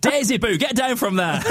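high, mock-stern dad call; calling children in. Dad telling his daughter off from afar, calling out. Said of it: "I come out and go".

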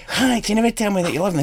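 a hint of a pleading wife; guitarist's wife. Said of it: "And she goes".

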